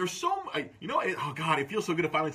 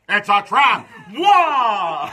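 loud, high alarmed battle cry; Admiral Ackbar; 5 semitones higher, 12 dB louder, much slower than usual. Acted. Fan imagining the admiral's sacrifice, hammed-up shouted impression. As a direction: bellow the warning, then wail. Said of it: "They could have had Admiral Ackbar".

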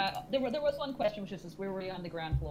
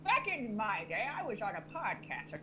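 wicked stepmother; 9 semitones higher, 3 dB louder than usual. Acted. Parent bragging to child scene.

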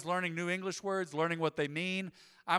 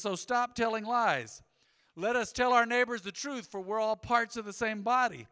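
scripture proclaimed; 5 semitones higher, 6 dB louder than usual. Acted. Reading scripture aloud to congregation.

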